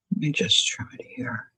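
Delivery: quiet whispered aside to herself. Real, not acted. Crafter whispering to herself over the glue, soft. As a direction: breathe it out under the breath while working.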